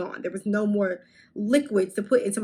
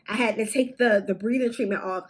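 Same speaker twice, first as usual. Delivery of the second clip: usual speaking voice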